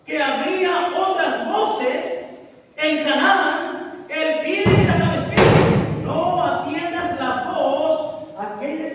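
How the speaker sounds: slow, emphatic preacher, drawing out key words; impassioned preaching. Real, not acted. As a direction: warn the congregation with drawn-out force. Preaches at full force. (predicación enfática y pausada)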